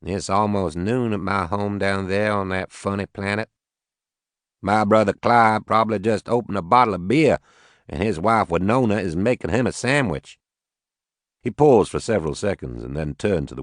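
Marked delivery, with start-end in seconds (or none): 0.0-10.4 put-on easygoing Arkansas farmer, Southern accent. Max to the Watanabes, a light Southern accent. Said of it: "He had a heavy southern accent".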